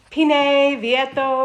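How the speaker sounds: a hammed-up Italian accent on the made-up names; mock Italian. A manager guessing the countertop name in mock Italian. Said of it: "something Italian".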